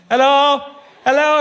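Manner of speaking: high, loud hello; calling out. Retold or imitated. Driver calling to Mandela from the car, loud and high. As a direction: call out through the rolled-down window.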